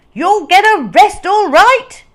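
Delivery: high, loud triumphant shout. Acted. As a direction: bellow it as you burst in. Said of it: "shouted Mister Dart".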